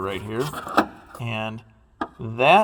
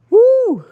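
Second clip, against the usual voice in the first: very high, loud "woo"; loud whoop; 11 dB louder than usual. Real, not acted. Mechanic as the bumper comes loose, high whoop. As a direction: let out a piercing whoop.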